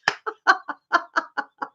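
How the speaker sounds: high, soft run of laughs; laughter tailing off. Real, not acted. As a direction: let the laugh fade, still high. Laughing fit continues.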